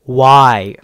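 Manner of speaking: loud, drawn-out exasperated why; exasperated shout. Real, not acted. Gamer yelling at the game about the gadget, exasperated shout. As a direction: yell it at the screen in disbelief.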